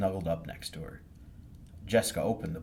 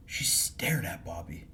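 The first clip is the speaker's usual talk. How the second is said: whisper; slower than usual. A narrator's reading. Whispered narration.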